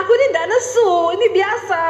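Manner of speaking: high-pitched protest; retold indignant denial; imitated angry tone. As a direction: protest in a high, cross-sounding tone. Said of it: "Tapi nadamu tuh nesu".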